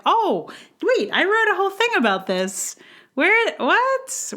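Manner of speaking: high-pitched, startled remembering; acted sudden realisation. Podcaster retelling the moment she remembered in the car, acted surprise. Acts out her sudden recollection.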